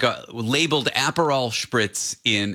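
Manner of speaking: ordinary talk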